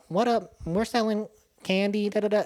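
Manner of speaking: lightly voiced young salesman; boys selling candy. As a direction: chirp it like a kid at the door. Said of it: "They're like".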